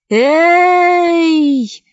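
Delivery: distant echoing call; cave echo. Acted. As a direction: sound like an echo from far below. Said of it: "Эхо отозвалось".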